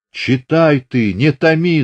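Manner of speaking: mother cries out high and loud, begging her to read; anxious shout. A narrator's reading. Старая мать торопит дочь читать письмо, тревожно кричит. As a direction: shout it, unable to bear the wait. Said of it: "закричала Ильинишна".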